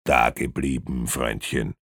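low, quiet warning; a hint of a threatening heavy; menacing inspector. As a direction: stop him with a low, soft order. Inspector orders the man to stay.

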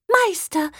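tiny doll voice. Acted. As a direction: call out like a delighted little harp. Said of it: "rief die entzückende Puppenharfe".

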